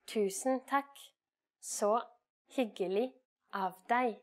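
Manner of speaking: Tusen takk, så hyggelig av deg said slowly, word by word; slow and clear. Shown as a demonstration. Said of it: "Here we have just added".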